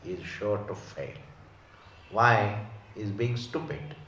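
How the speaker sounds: ordinary talk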